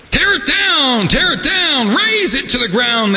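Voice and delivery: high, hammed-up shouted chant; mock battle cry. Host voicing the Edomites' cry, hammed-up high shout. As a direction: yell it like a mob tearing down a city. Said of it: "they cried".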